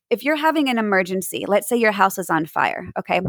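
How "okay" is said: usual speaking voice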